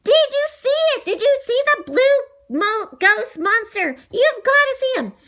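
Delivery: high, loud and urgent; excited yelling. A narrator's reading. Boy desperate for witnesses to the monster, excited yelling. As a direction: yell for everyone to look.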